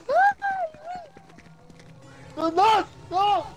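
high terrified shriek; panicked scream. Real, not acted. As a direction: scream as it grabs you. Caught by animatronic, screams.

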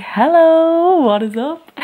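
high, loud hello that drops off; mock vlog greeting. Vlogger mimicking herself popping on for viewers, mock greeting. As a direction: send up your own big hello.

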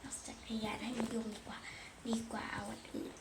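hushed unvoiced aside; breathy secret aside; whisper. Real, not acted. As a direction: whisper it so nobody hears.

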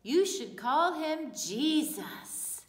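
slow, loud angel voice. Acted. Storyteller voicing the angel naming the baby, slow and grand. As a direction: announce it grandly and slowly. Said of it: "the angel had said".

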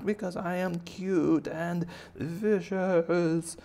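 high bunny voice, slowing into menace; defiant baby bunny. Acted. Bunny shrugging off gunfire, high drawn-out boast. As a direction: boast high and stretch the final words. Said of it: "and he's like".

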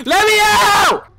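long, high, loud wail; desperate yell. Real, not acted. Gamer begging the level to release him, desperate yell. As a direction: drag it out, yelling to escape. Stuck in the game.

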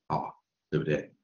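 ordinary delivery

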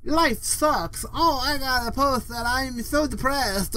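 high, drawn-out mock whine; mocking complainers. Imitating negative posters on social media, mock whine. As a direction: draw it out in a high mocking moan. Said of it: "They all say".

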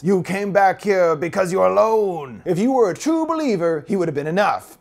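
demonic voice. Retold or imitated. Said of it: "now speaking in a low, demonic voice".

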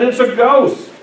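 ordinary talk

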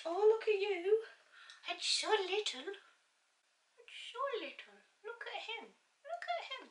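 high, soft cooing at something tiny. Real, not acted. Unboxing a tiny lipstick, soft high cooing.

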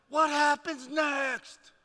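slow, loud, stretched-out demand; mock desperate shout. Viewer left hanging by the show, drawn-out mock shout. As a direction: cry it out, hammed-up and desperate.

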